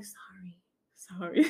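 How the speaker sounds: tiny whispered sorry, very soft; meek apology. Retold or imitated. Child apologising to mom after being corrected, meek and whispered. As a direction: imitate a small, sheepish whisper.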